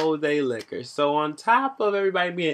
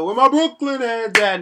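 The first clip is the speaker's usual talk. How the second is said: DJ hype call; 7 semitones higher, 7 dB louder than usual. Retold or imitated. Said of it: "he just kept going back to".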